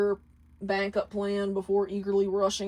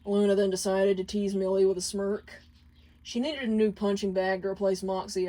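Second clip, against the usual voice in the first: plain reading voice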